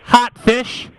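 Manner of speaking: loud. Acted. Prank caller repeats to agent.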